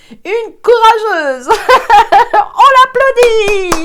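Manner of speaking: high, loud, slow cheer with a laugh; excited cheer. Real, not acted. To viewers, hailing the brave new colleague: excited laughing cheer.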